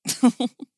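short acted chuckle; amused little laugh; can't-help-it chuckle. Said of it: "started chuckling".

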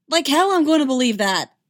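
loud, high, scornful retort; scornful shout. A narrator's reading. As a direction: spit it out in disbelief. Trooper scornfully refuses.

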